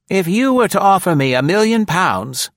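high put-on woman's voice, loud and sharp; high, loud sassy secretary; tart secretary Nora. A narrator's reading. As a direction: tease the boss from the back seat.

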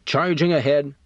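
narrated flash of temper; exploding. A narrator's reading. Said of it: "Carl exploded".

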